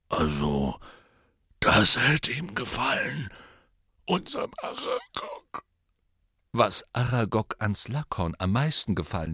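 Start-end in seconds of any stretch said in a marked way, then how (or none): 0.1-5.6 choked up, breaking at the end; tearful. Acted. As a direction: voice cracking into a cry. Hagrid weeping over Aragog.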